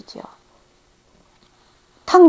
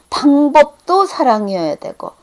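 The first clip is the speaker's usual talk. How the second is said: ordinary talk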